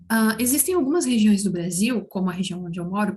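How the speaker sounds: ordinary delivery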